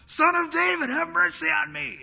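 loud, very high imploring cry. Retold or imitated. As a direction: shout out the plea at high pitch. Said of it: "he cries louder".

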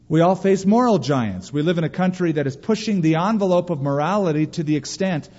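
ordinary talk

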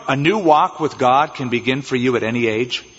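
normal talking voice

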